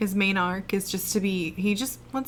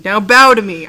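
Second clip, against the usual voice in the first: much louder, hammed-up tyrant demand; mock triumphant command; 15 dB louder than usual. Joking to co-host after beating Bison, mock-tyrant shout. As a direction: bellow it like a conquering villain.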